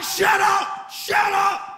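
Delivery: retold angry shout at his scared son; yelling at crying son. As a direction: bark shut up at the kid in the back seat. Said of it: "And I'm like".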